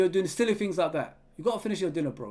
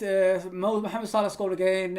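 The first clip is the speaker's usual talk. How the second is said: normal talking voice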